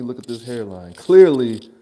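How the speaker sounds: normal talking voice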